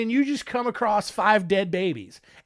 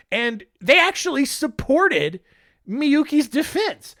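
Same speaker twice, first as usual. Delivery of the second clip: ordinary talk, nothing marked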